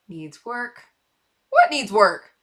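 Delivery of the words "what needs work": loud, incredulous challenge; playful outraged question; mock indignation. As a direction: throw it back as if insulted. Objects to the comment just read.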